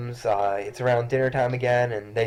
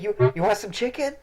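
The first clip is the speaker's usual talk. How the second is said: put-on very high, coaxing child voice; little girl offering; 12 semitones higher than usual. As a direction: coax the cat sweetly. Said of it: "and then is like".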